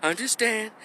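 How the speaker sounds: very soft, high little voice; soft, meek, mild. Shown as a demonstration. Showing how dad's wife sounded, soft, meek and high. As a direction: imitate her meekly, barely asserting yourself. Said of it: "real soft and meek and mild".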